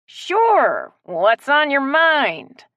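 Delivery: slow, through gritted teeth; clenched teeth. A narrator's reading. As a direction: grit your teeth and force it out. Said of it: "between clenched teeth".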